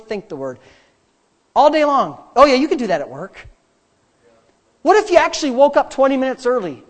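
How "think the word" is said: "think the word" dropped low and softer; inward answer. Shown as a demonstration. Preacher drilling day-and-night habit, "think the word" low and inward. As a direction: turn "think the word" inward, lower and quieter. Chanted contrast of talk, think.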